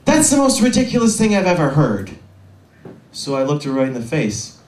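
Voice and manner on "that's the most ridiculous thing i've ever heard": higher, louder huffy outrage from an offended customer; indignant customer. Retold or imitated. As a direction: scoff it like an outraged shopper. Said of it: "she's like".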